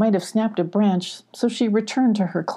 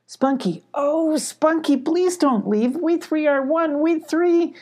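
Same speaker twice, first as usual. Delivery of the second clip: high, slow plaintive plea; acted near-crying lament; tearful pleading; 9 semitones higher, slower than usual. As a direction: plead after a friend, drawn out and high. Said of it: "Molly stopped and started to cry".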